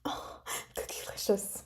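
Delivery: breathy whisper, almost silent. Real, not acted. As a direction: lean in and whisper the name. Teasing whisper to a chat member.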